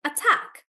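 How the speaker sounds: attack jumping high on TACK; stress on TACK. Shown as a demonstration. Teacher showing an exception, attack stressed high on TACK. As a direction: punch the second syllable TACK in attack. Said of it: "It's on the second syllable".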